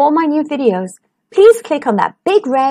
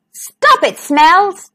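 cross Little Wolf; 6 semitones higher, much slower than usual. Acted. Little Wolf scolds baby brother.

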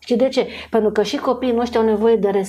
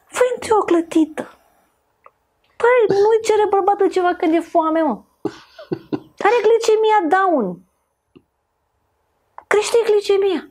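lively mock advice; 9 semitones higher than usual. Sfat exagerat, voce înaltă.

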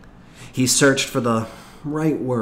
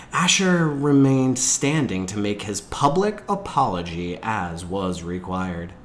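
usual speaking voice

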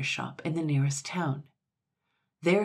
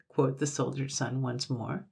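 ordinary delivery

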